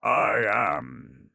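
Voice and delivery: a touch of a menacing rasp; rasping headman. A narrator's reading. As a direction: rasp it out as the ugly head. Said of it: "rasped the ugliest of the headmen".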